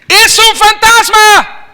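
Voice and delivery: high, slow, drawn-out ghost scream; terrified cry. A narrator's reading. Disciples screaming at a ghost on the water.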